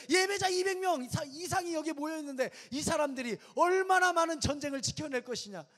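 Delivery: preaching builds to a loud high climax; rising to a loud peak of fervor; full-force preaching. Acted. Preacher rallies the youth congregation, full force.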